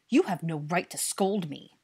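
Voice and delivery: loud, slow indignant retort; angry retort. A narrator's reading. Dennis objects to being scolded, angry. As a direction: push back angrily at the scolding. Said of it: "Dennis returned angrily".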